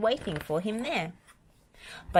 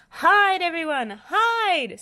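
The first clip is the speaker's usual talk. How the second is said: high, loud panicked warning; terrified scream; 13 semitones higher, 7 dB louder, slower than usual. A narrator's reading. Spud screams to everyone to hide, terrified. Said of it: "screamed Spud".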